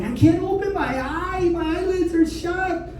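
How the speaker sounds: put-on high whiny little girl voice; whiny child. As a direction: complain like a small daughter. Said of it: "my daughter's like".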